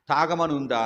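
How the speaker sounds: sharp, loud challenging question; indignant retort. Retold or imitated. Preacher retorting to the old pastor, loud and indignant. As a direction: fire the question back at him.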